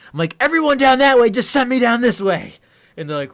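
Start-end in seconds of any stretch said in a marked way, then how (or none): 0.4-2.5 high, loud exasperated complaint; exasperated outburst. Retold or imitated. Sent back and forth, a loud high exasperated outburst. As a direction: rant it, pitched way up. Retold frustration being redirected.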